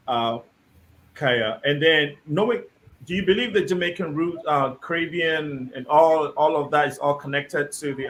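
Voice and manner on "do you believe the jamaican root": normal talking voice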